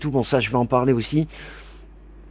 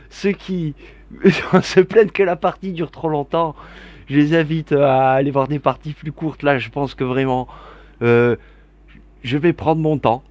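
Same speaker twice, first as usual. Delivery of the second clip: ordinary talk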